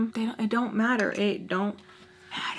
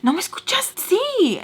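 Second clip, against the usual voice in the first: much higher, louder, teasing Spanish comeback; mock Spanish reply; 12 semitones higher, 4 dB louder than usual. Mocking Spanish reply to Peach.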